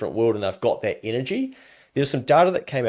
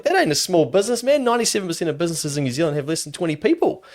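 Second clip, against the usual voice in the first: excited; 7 semitones higher, faster than usual. Retold or imitated. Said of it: "I'm like".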